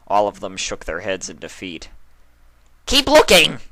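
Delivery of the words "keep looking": loud, high shout. A narrator's reading. Twilight ordering her friends, loud high shout. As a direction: yell the order across the town. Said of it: "she shouted".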